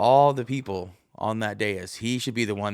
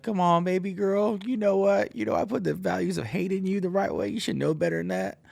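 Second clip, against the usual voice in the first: high, sing-song parent voice; mock parent scolding; 9 semitones higher than usual. Parent chides baby girl about hate values, high mock voice. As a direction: tease like a proud bigoted dad.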